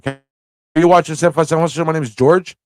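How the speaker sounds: ordinary delivery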